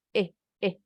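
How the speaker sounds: eh, eh as two short isolated vowels; short I sound. Shown as a demonstration. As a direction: demonstrate eh twice, each cut short. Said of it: "bunyi I pendek". (bunyi I pendek, eh, eh)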